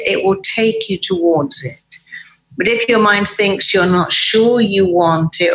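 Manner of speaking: usual speaking voice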